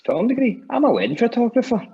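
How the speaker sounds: high-pitched, loud comic disbelief; incredulous mock denial. Storyteller acting the assistant director's shocked reply, high and incredulous. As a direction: imitate a startled man blurting out a denial.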